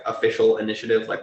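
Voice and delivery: usual speaking voice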